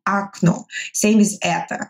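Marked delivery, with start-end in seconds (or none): 0.1-0.6 "окно" as a-KNO, stressed on the last syllable; first o of "окно" becomes a; reduced o. Shown as a demonstration. Teacher repeating the model word, "окно" said a-KNO. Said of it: "We say окно".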